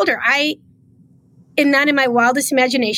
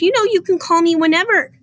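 higher, soothing voice imitating the mayor; higher, mock-gentle imitation of the mayor consoling; 7 semitones higher than usual. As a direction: pat the arm and play it sweet. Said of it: "grabbed my arm nicely, like, to console me".

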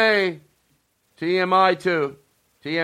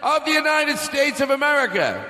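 announcer shout; 8 semitones higher than usual. Acted. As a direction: announce it to the whole arena. Grand introduction, announcer shout.

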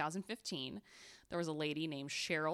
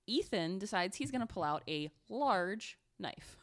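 normal talking voice

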